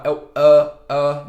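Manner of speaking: é then a, both loud; loud filler contrast. Shown as a demonstration. Teacher loudly contrasting é and a. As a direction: punch the fillers é o a. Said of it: "não é o é".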